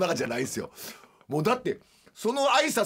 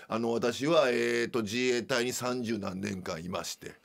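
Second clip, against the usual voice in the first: low, subdued self-introduction of a stern veteran instructor; instruktur mantan tentara yang kaku; 8 semitones lower, 5 dB quieter than usual. Retold or imitated. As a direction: imitate a tense, formal greeting, sinking ever lower and softer. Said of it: "全然トーンちゃうんすよ".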